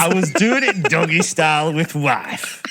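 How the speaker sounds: slow, broad Yorkshire reading; Yorkshire accent. Shown as a demonstration. Co-host reading his confession on request, Yorkshire accent. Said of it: "read the beginning of that in a Yorkshire accent".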